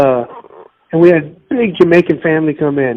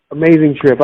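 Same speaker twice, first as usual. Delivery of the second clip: normal talking voice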